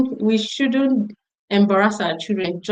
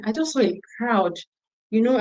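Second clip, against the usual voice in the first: usual speaking voice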